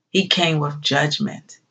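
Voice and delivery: normal talking voice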